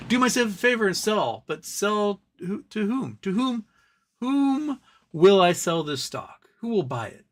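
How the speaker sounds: indignant, mocking questions; incredulous mockery. Real, not acted.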